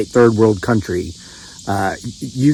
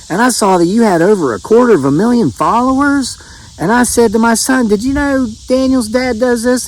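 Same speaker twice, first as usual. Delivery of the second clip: higher, slowing excited gossip voice; excited woman; 7 semitones higher than usual. Retold or imitated. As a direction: impersonate an amazed acquaintance, pitched high. Woman excitedly retells seeing him online.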